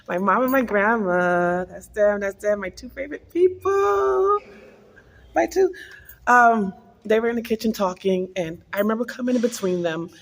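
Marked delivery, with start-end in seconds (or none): 2.6-5.7 high, sweet doting voice; fond coo. Real, not acted. Showing family photo to viewers, high fond coo. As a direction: coo over the photo lovingly.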